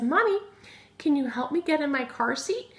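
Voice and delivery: imitating a young daughter asking for help; little daughter voice. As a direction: ask sweetly like a little girl, "car seat" with a clear R. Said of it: "She said".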